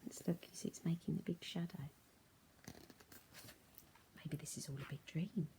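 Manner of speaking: soft whispered wonder. A narrator's reading. Teacher to kids, suspenseful whisper. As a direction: hush it in the dark.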